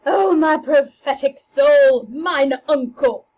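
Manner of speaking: slow, loud, pained outburst; anguished cry. Acted. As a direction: cry it out in shock. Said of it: "says Hamlet".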